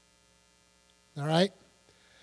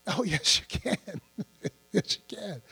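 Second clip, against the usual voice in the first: soft laughing whisper; 8 dB quieter than usual. Real, not acted. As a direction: chuckle the answer under your breath.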